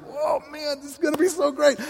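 high, gleeful anticipation; excited Jesus. Acted. Jesus thrilled about your future, high and gleeful. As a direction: bubble over with joy. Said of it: "the excited Jesus".

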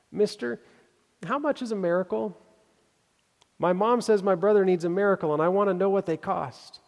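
put-on a little girl pleading at the counter. Little girl asks pharmacist.

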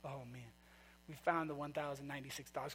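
hushed relieved murmur; relieved sigh. Retold or imitated. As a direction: sink into relief as the worry drains. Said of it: "that relief".

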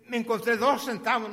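high, loud, thrilled announcement; acted-out childish excitement; excited little boy. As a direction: announce your great find to mom. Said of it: "pero excitado, excitado, excitado".

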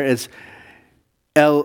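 ordinary talk, nothing marked

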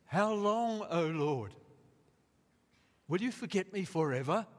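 high, loud pleading lament; anguished cry. Acted. Psalmist pleading with the Lord, anguished loud cry. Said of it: "The psalmist says".

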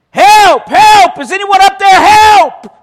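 high, loud desperate cries for help; yelling for help. Acted. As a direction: scream up the cliff as if dangling. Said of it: "So Jack began yelling for help".

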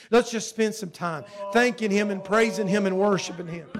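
loud, pressing exhortation to worship; fervent preaching. Real, not acted. Preacher urging church to praise a loving father, fervent preaching.

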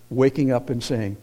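ordinary talk, nothing marked

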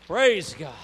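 exultant shout. Real, not acted. Preacher praising God after a conversion story, exultant shout. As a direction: burst out in praise, high and loud.

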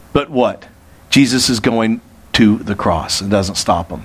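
normal talking voice

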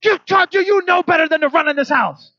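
put-on yelling at the kids, loud and high; a parent losing it, loud and high; angry yell. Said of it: "I blow up on them".

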